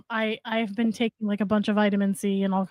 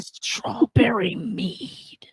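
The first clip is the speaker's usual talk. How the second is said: whispering; much slower than usual. Real, not acted. Reading out new bottle.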